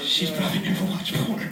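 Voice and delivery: quiet aside. Real, not acted. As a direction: drop it to a confiding whisper. Aside muttered to audience.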